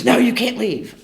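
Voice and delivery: friend insisting. Retold or imitated. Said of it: "And he goes".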